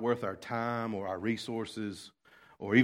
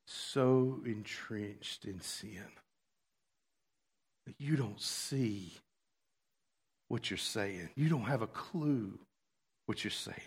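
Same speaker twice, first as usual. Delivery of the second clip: hushed rebuke; 5 dB quieter, slower than usual. Pretend. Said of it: "standing up in that moment and going".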